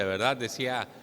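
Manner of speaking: ordinary talk, nothing marked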